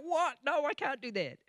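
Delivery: hammed-up aghast [name]; shocked [name]. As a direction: recoil at the order in disbelief. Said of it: "He's going".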